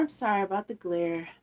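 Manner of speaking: ordinary delivery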